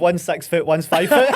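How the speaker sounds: laughing loudly, pitch jumping high. Real, not acted. Laughing as he jokes to his friend about their heights. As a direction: joke through a burst of laughter.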